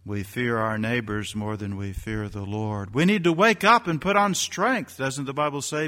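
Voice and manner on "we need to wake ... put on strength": higher and louder wake-up cry; rallying preacher call. Acted. Preacher rousing believers to wake up, high and loud. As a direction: rouse them to put on strength.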